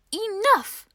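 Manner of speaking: high, loud, fed-up cry; mother's angry shout. Acted. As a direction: shout it to end the chaos. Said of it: "said her mother".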